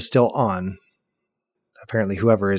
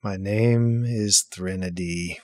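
through a wall; 2 semitones lower, 4 dB quieter, slower than usual. Acted. Character speaks from inside coffin.

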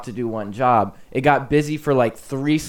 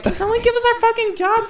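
much higher squeaky yelled line; mock outburst; 3 dB louder than usual. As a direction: blurt it like a frustrated worker. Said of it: "He was like".

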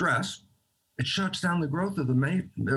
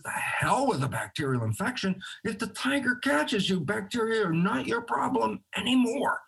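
mock-emphatic dismissal, pitched much higher toward the end; 8 semitones higher than usual. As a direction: wave the worry away in a climbing, high voice. Quoting Robert dismissing the infection.

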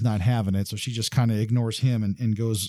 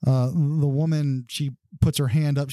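ordinary delivery

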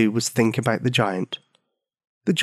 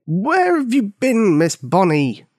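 high, slow and stern questioning; a stern old keeper scolding a child; stern old man; 8 semitones higher, slower than usual. A narrator's reading. Old man scolding Bonnie, stern. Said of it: "The Old Mal asked sternly".

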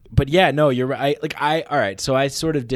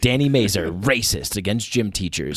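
mock news announcer; 4 dB louder than usual.

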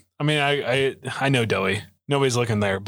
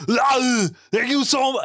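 Arn voice; 10 semitones higher, slower than usual. Retold or imitated. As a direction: read the letter as Arn. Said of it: "I don't do a good Arn".

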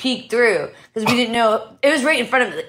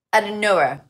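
ordinary delivery

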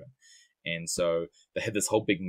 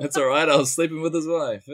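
joking confession; 8 semitones higher, 7 dB louder than usual. Pretend. Joke confession, laughing.

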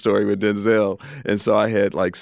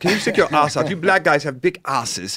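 high and loud, crude demands; the director pushing the actor, louder and higher; pushy English director; 7 semitones higher, 5 dB louder than usual. Retold or imitated. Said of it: "there is a director from England".

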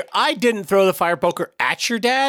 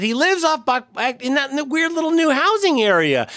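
put-on old man voice; 10 semitones higher than usual. I kinda lost the character.

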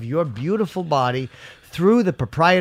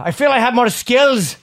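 put-on terrible Irish accent; 7 semitones higher, 5 dB louder than usual. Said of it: "I do a terrible accent".

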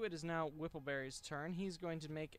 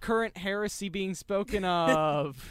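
mock solemn; 4 semitones higher, 13 dB louder than usual. Mock solemn heresy speech.

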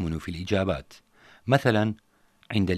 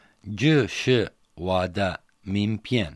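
slow repeat after teacher; much slower than usual. Shown as a demonstration. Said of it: "هذه بطاقتي تعريفية".

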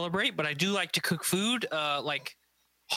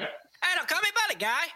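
high, squeaky cartoon Canadian voice; South Park Canadian accent; 12 semitones higher than usual. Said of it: "South Park Canadian".

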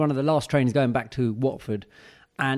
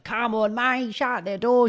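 much higher, slow, imitating a drunk girl's yell; drunk girl shouting; 8 semitones higher, slower than usual. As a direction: mimic a tipsy girl calling down the carriage. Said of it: "She was shouting out something".

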